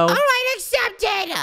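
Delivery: high squeaked verdict; squeaky acceptance. Acted. Nico accepts the singer in a high squeak. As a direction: squeak it out as judge.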